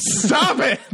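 high, loud laughing yell; laughing protest. Real, not acted. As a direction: shout them down through laughter. Protests teasing about poop.

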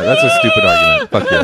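same insult in a cartoon squeak; squeaky mock retort. Redoing the friend's comeback as a high squeak.

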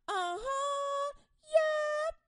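high, knowing "uh-huh, yup"; high sassy agreement; girls signifying. Retold or imitated. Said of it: "the girls are signifying".

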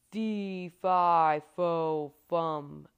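huge, very loud giant's voice; thunderous storybook giant chant; booming giant. A narrator's reading. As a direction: boom out each word, long and heavy. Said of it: "boomed the voice".

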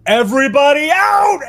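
booming shout, high and loud. Acted. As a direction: boom it out to clear the oasis. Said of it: "in a really loud, booming voice".

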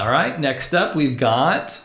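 ordinary talk

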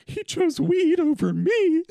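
high, loud, drawn-out mock wail; mock sobbing. Breaking down in the car over a crush, mock sobbing. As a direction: wail it slowly in a hammed-up breakdown. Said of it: "breakdown sobbing because I'm like".

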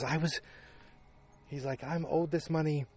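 half-acted protest, voice lifted; pleading Lane. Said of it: "Lane pleaded, Lane begged".

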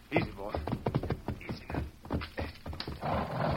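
soft, low soothing murmur; calming a scared dog. Acted. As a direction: gently settle a nervous animal. Soothing an animal while disarming.

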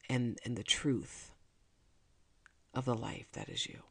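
soft, intimate prayer of gratitude; heartfelt prayer. Real, not acted. Thanking God as her best friend, hushed heartfelt prayer. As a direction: pray tenderly and quietly, leaving room between phrases.

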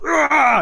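high, loud battle roar; big rasping "aah"; muscle-flexing roar. Acted. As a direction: roar as you flex. Flexing lizard muscles, roars.

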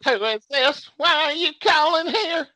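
high-pitched mock outrage; disdainful preacher-like mom. Mom demands to know who's calling, mock disdain. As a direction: imitate mom's scornful demand. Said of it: "you can just hear the stain".

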